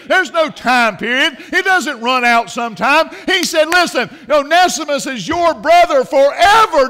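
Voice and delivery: loud, high pulpit insistence; forceful preaching. Real, not acted. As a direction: hammer the promise home.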